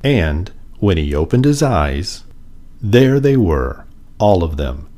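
ordinary delivery, nothing marked

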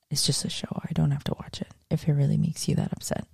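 soft, fast, mock-apologetic whisper; sarcastic whisper. Real, not acted. To offended viewers, a snide hushed whisper. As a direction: hush it out like a snide aside.

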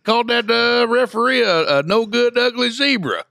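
high, loud mock gossip of an angry townsman; outraged local. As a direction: ham up a scandalized small-town busybody.

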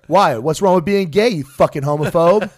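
loud, barking drill sergeant. Retold or imitated. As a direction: bellow it at the recruit. Drill instructor yelling.

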